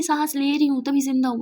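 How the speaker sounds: snippy comeback; sarcastic retort. Acted. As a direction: throw it back, sulking.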